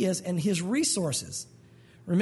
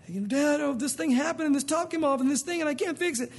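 put-on higher-pitched kid pleading with dad; distressed child; 6 semitones higher than usual. Kid bringing a broken toy to dad, distressed child voice. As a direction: voice an upset little kid.

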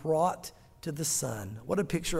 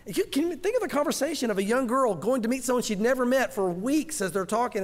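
usual speaking voice

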